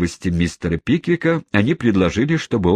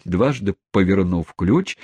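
ordinary delivery

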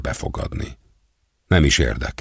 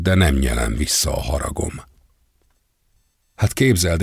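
ordinary delivery, nothing marked

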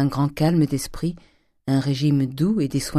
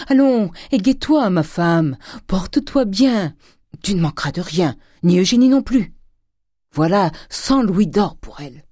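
high, hearty encouragement; rally her in loud bursts; Grandet, eager cheer; 6 semitones higher, 5 dB louder than usual. A narrator's reading. Husband cheering his wife with gold, eager coaxing.

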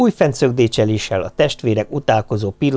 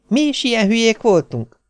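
high, doubting question; doubtful; 12 semitones higher than usual. A narrator's reading. Wife wonders to her husband about their youth, doubtful. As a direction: ask it in disbelief, pitch lifted. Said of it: "kérdezte kétkedőn".

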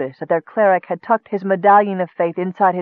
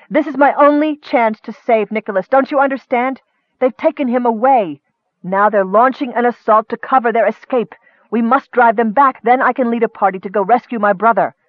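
high-pitched, pressing argument; impassioned, determined urging; urgent insistence; 5 semitones higher, 3 dB louder than usual. Acted. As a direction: plead the case forcefully, pitch raised. Urgent argument to save brother.

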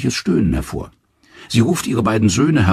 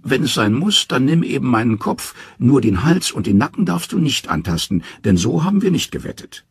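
high, sly trickster voice; Loki, cornered; 7 semitones higher than usual. Acted. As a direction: give in with a cunning twist. Loki's loophole.

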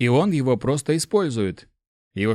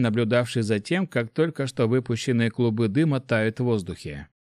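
usual speaking voice

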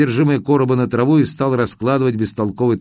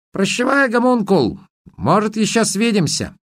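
calling out farewell; 10 semitones higher than usual. Acted. Farewell called out.

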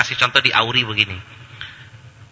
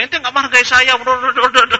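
shrill high mock fury, much louder; hammed-up lecturer rage; 7 dB louder than usual. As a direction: ham up a lecturer losing it over homework. Over-the-top lecturer raging at student.

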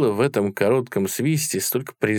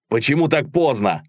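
sharply raised, higher question; called out in passing; 5 semitones higher, 3 dB louder than usual. A narrator's reading. Karpov shouting to Viktor as he rides, brisk demanding call. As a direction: call it out on the move. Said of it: "крикнул он на ходу".

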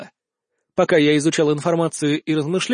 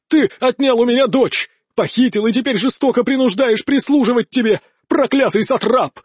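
hammy stage actor; 5 semitones higher than usual. A narrator's reading. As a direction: overact for the crowd. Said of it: "пафосно воскликнул он".

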